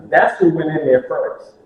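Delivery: ordinary talk, nothing marked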